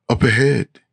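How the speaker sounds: lightly shrilled call. A narrator's reading. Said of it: "Eve shrills".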